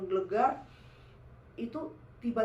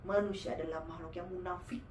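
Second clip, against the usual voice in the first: possessing jinn; 5 semitones lower, 4 dB quieter than usual. Retold or imitated. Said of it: "Dia kata".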